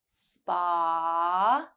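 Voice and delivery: "Spa" with a long drawn-out ah; held ah. Shown as a demonstration. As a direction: stretch the ah of "Spa". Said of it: "ah sound at the end".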